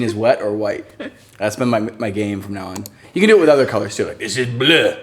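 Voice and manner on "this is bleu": put-on terrible French accent. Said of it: "terrible French accent".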